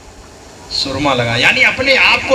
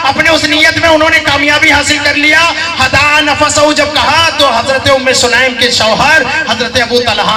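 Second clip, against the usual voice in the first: raised preacher's voice; fiery preaching; 8 semitones higher than usual. Acted. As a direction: build it up like a pulpit climax. Preacher at full force.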